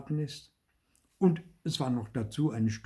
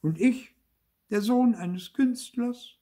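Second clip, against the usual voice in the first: high, indignant puppet lament; talking to himself; 12 semitones higher, 4 dB louder than usual. A narrator's reading. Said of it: "sprach Zäpfelkern zu sich selber". (hoch und gekränkt, zu sich selbst)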